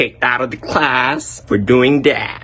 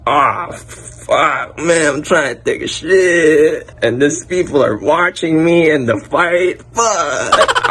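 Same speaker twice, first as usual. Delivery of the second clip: put-on annoyed kid; slower than usual. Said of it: "the kid was just like".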